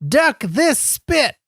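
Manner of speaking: high, slow catchphrase cry; mock yell. Shown as a demonstration. Suggesting a family friendly catchphrase, high mock yell. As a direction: draw out each word like a hype line. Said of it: "yell something more family friendly".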